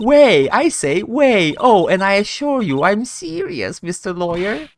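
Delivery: teasing, theatrical witness voice; a hint of a haughty witness; lightly voiced defiant witness. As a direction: toy with the lawyer, stretching the first words.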